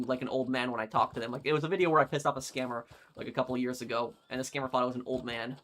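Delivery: normal talking voice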